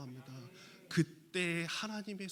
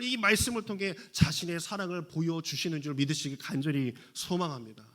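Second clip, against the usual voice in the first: loud, raised preaching voice; forceful preaching; 4 semitones higher, 7 dB louder than usual. Real, not acted. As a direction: drive the message home to the congregation.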